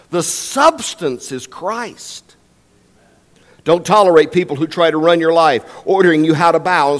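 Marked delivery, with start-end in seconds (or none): 0.1-2.4 The substance is Christ, drawn out slowly word by word; slow emphasis. Shown as a demonstration. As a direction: let each word of Christ land slowly. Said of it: "repeats the key line slowly".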